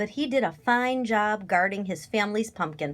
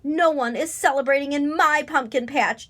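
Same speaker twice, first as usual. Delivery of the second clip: louder, higher, firm protest; defiant yell; 5 semitones higher, 5 dB louder than usual. A narrator's reading. Scarecrow yelling at a crow, loud defiance. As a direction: yell it as a warning. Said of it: "Jeb yelled at Bernie Crow".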